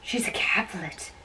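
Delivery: breathless whisper of dismay; shocked whisper. Acted. Romeo whispering to himself, learning Juliet is a Capulet. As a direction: whisper it as the truth sinks in.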